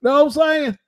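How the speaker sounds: high Southern boxer voice, boasting; Butterbean impression. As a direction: brag like Butterbean about Wilder.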